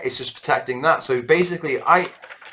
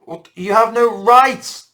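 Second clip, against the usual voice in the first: indignant mock decree; 9 semitones higher, 6 dB louder, much slower than usual. Mocking imposed rule.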